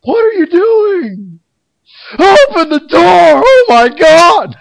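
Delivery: very high, slow, loud hammed-up shriek; agitated attendant. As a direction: scream it like a frantic New Yorker losing it. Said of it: "he just got really agitated over it".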